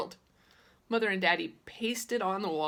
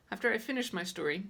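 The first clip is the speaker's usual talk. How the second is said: ordinary delivery, nothing marked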